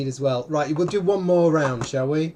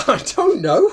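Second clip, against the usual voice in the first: laughing; 13 semitones higher, 10 dB louder than usual. Real, not acted. Laughing at a confusing drawing.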